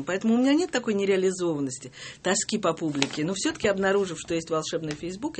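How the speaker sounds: ordinary talk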